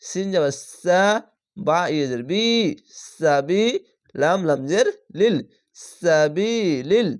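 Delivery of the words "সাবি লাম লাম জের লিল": ordinary talk, nothing marked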